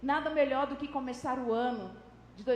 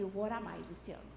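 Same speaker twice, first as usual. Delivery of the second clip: quiet resolution; 6 semitones lower, 7 dB quieter than usual. Retold or imitated. Acting a new year's promise.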